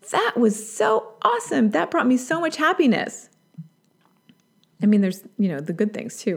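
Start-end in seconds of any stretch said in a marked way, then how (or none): 0.1-3.3 higher-pitched, happy gush; voicing a joyful example; delighted realization. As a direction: say it thrilled, pitch lifting. Acts out recognizing a happy emotion.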